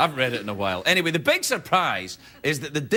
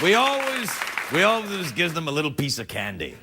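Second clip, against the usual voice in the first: normal talking voice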